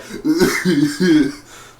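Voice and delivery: high, hammed-up sobbing noise; mock crying. Reviewer imitating Felicity crying at the end, mock sobs. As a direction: imitate whimpering sobs, half laughing. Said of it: "even she's crying".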